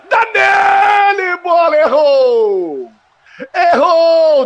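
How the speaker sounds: slow, drawn-out commentator yell; excited shout. Real, not acted. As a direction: yell the miss as it happens. Goal chance missed, shouting.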